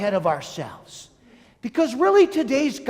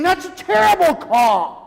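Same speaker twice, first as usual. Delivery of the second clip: loud, higher fan yell of protest; mock outraged fan; 7 semitones higher, 5 dB louder than usual. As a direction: shout it like a furious Dallas fan. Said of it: "Dallas fans are screaming".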